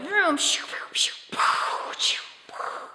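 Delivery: whispered zooming and blaster noises; imitating a boy playing Star Wars at his desk; whispered sound effects. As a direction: hiss the spaceship sounds under your breath.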